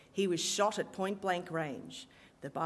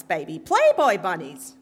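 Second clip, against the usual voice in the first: put-on much louder Brooklyn accent, "Playboy" hammered; "Playboy bunnies" belted in a Brooklyn accent; emphatic Brooklyn repeat; 12 dB louder than usual. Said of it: "in her strident Brooklyn accent".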